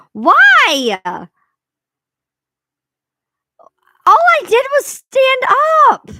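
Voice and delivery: high loud cry of disbelief; yelling at bad luck; exasperated outcry. Real, not acted. Venting to viewers about standing up, loud exasperated cry. Frustrated after eye got stuck.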